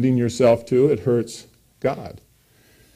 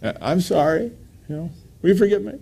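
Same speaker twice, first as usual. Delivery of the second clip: put-on kid apologizing; 6 semitones higher, faster than usual.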